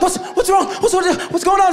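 high-pitched frantic questioning; panicked manager. Retold or imitated. Manager running over to screaming woman, high mock panic. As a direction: imitate a flustered manager rushing in.

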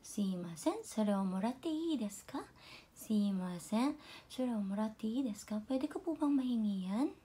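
soft, humble request to the boss; very polite. Shown as a demonstration. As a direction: ask your boss humbly and softly. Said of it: "Oh, napakagalang". (napakagalang na pakiusap sa boss)

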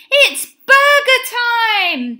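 high, loud, drawn-out announcement; eager cry. A narrator's reading. Hungry boys spot burger Benny, loud eager cry. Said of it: "they cried".

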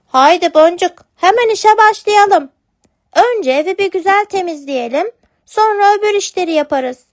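sweet kitten reassuring her father. Acted. Kitten proposes chores. (yavru kedi sesi, istekli)

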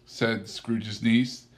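ordinary delivery

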